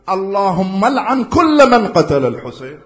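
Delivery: stronger repeated curse. Acted. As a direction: raise it up and lean in hard.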